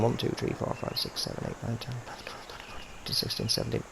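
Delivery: quiet whispered count under breath. Real, not acted. Whispered counting of putt slope.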